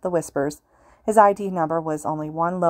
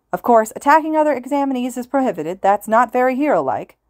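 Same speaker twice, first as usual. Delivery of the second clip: higher, louder radio host voice; loud announcer; 7 semitones higher, 5 dB louder than usual. A narrator's reading. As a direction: lay down the rules loudly. Hero states rules.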